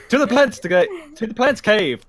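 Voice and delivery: loud, high hammed-up superhero announcer; Batman show voice. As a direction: boom it like an old Batman episode. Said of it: "an episode of Batman".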